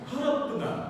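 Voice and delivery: cinema announcement, each word crisp; announcer read. Shown as a demonstration. Teacher demoing an announcement for students. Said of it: "ini adalah ucapan huruf H".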